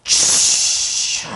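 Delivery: a long unvoiced whoosh forced up from the belly; forceful rushing breath. Testifier reenacting the Spirit's force in her body, breathy whoosh. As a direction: push the air out hard like a gust of power. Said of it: "뱃속에서 나오는 어마어마한 힘으로".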